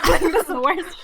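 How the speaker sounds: high-pitched, through real laughter; laughing. Real, not acted. Storyteller recalls awkward hallway moment to friends, laughing. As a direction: crack up while groaning about it.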